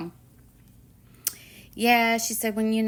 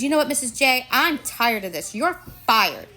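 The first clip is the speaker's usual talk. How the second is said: mock-indignant, raised voice; retold huffy outburst; 6 semitones higher, 6 dB louder than usual. Child firing her teacher after stomping back, retold in a huff. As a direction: imitate a fed-up child, louder and higher. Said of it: "she huffs and puffs".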